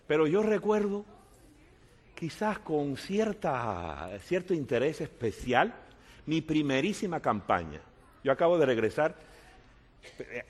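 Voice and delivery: normal talking voice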